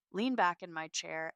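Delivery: ordinary delivery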